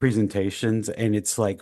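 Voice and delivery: normal talking voice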